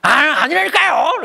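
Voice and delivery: loud, high, drawn-out mock protest; hammed-up defiant child's yell; talking back. Child defying a teacher in a bad mood, acted angry protest. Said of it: "막 어른들한테나 선생님한테 막 대들고 싶은".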